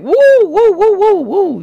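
high, loud whooping woo; excited woo. Retold or imitated. Preacher whoops at salty food, excited high woo.

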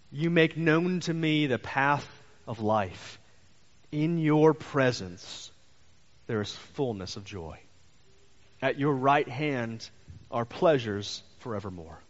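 slow, solemn reading of the psalm; scripture reading. Acted. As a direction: read each phrase slowly and reverently. Reads the psalm aloud.

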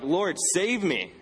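mildly urgent appeal to God; crying out. Acted. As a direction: stretch out an acted cry for help without shouting. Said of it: "he's crying out to God".

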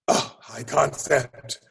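looks down on the term, drawn out and dismissive; snobby sneer. Retold or imitated. Writing snob sneering at the phrase, slow and sniffy. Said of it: "I apologize to every writing snob".

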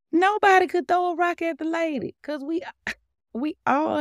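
exclaiming nobody could. Real, not acted. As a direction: lift it up, preaching hard. Preaching emphasis.

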